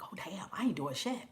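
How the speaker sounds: whispered, caught out; sheepish whisper. Retold or imitated. She admits to the mother ayahuasca she did nothing, whispering. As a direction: breathe it like a busted confession. Retelling her own sheepish reply.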